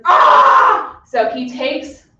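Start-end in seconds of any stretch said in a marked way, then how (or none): 0.1-1.0 sudden high, loud yell of rage; a hammed-up monster's scream; angry giant's roar. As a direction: roar like a furious blinded giant. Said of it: "he's like".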